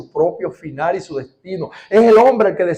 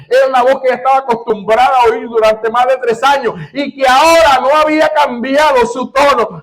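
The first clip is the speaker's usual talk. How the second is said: loud, high, fervent sermon delivery; preaching at full force; 7 semitones higher, 8 dB louder than usual. Acted. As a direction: proclaim it to the whole congregation.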